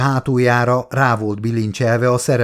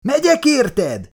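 determined shout; 13 semitones higher, slower than usual. Acted. Charlie shouting to Ellie in storm.

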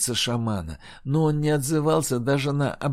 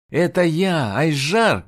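loud call, higher; 7 semitones higher, 7 dB louder, slower than usual. A narrator's reading. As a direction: call out loudly to him. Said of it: "громко сказала девочка".

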